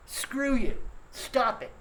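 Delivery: normal talking voice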